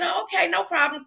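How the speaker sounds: high-pitched, quick-to-please okay; mock compliance. Acting out eager compliance with boss.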